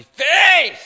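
loud, high, drawn-out comic outburst; exasperated shout. Real, not acted. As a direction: build slowly into a yell of frustration. Comic outburst about congestion.

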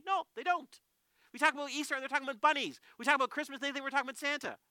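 high, loud rebuttal; emphatic exasperation. Real, not acted. Preacher corrects Christians about being understood, exasperated. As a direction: burst out to knock down the assumption. Emphatically refutes common assumption.

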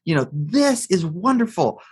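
acted childlike awe; lit-up delight. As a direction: marvel at a precious find, stretching the key words. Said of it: "he lights up and he says".

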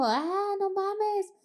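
very high yell of «no mames»; screamed swearing. Retold or imitated. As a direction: shriek it in pure adrenaline. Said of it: "todo el swearing que pude". («no mames» gritado muy agudo)

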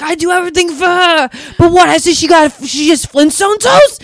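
high-pitched mock yell; retold yelling. Imitating Ron coming in to protest about Flintstone toes, mock yelling. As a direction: complain up high as the aggrieved boyfriend. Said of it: "Ron comes in yelling".

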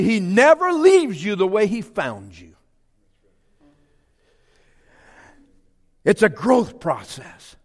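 loud, high-pitched preaching; fiery preaching. Acted. Pastor preaching to the congregation at full force. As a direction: preach it out to the whole church.

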